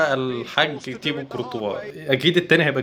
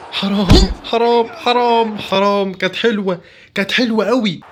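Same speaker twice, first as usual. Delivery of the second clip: frustrated exclamation; 6 semitones higher, slower than usual. Real, not acted. Gamer to his viewers after a near miss, frustrated. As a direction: cry out over the missed chance. Missed chance in match.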